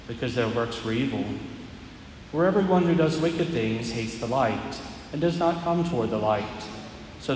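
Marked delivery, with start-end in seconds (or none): none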